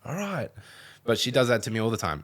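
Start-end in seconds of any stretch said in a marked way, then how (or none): 0.0-0.5 high meek little okay; a hint of a cowed kid; meek reply. Son answering his mum: lightly voiced meek reply. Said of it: "I'm like".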